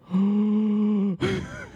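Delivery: shocked gasp. Retold or imitated. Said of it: "I was like".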